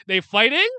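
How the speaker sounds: loud, high, stunned question; can't believe they're actually fighting; high rising disbelief; shocked disbelief. Real, not acted. Disbelief the characters start fighting.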